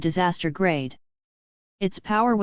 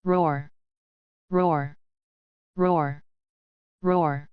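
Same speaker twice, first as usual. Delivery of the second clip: orc roar; much slower than usual. A narrator's reading. Orcs roaring in fury.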